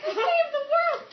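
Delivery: very high, loud squeal of victory; mock triumphant cry. Dreamer celebrates as the sun comes out, squealing in triumph. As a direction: crow it to the skies. Triumph after solving puzzle.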